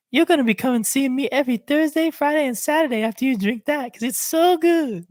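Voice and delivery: very high, loud Canadian lady imitation. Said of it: "in a Canadian voice".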